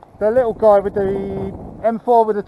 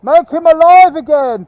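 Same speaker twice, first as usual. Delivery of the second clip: loud, slow, high call; loud, drawn-out urgent plea; 8 semitones higher, 9 dB louder, slower than usual. Real, not acted. Pleading for downed teammate's revival.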